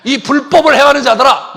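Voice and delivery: high, very loud condemnation; acted angry shout. Preacher imagines Jesus rebuking lawless judges, acted angry shout.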